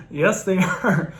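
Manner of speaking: amused, laughing agreement. Real, not acted. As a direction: laugh it out, drawn out.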